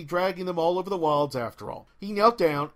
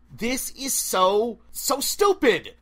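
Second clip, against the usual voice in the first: exasperated declaration; 5 semitones higher, 4 dB louder, much slower than usual. A narrator's reading. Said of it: "she declared".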